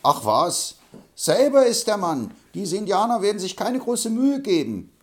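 put-on touchy old braggart, offended; indignant Hobble Frank. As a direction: bristle at being thought afraid.

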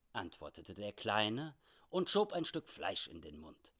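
ordinary delivery, nothing marked

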